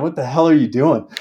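laughing self-scold; amused self-rebuke; laughing at himself. Retold or imitated. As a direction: chuckle through the question. Scolding himself mid-chase.

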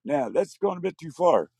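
loud, high whoa whoa; alarmed shout. Retold or imitated. Father stopping the mother, loud and alarmed. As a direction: cut in to stop her. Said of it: "my dad goes".

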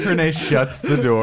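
cracking up mid-sentence; laughing. Real, not acted. Laughing at the story.

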